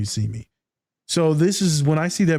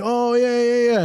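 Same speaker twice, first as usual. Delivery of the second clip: brushing it off; 9 semitones higher, slower than usual. Retold or imitated. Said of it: "I'm like".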